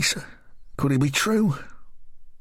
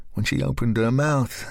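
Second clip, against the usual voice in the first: usual speaking voice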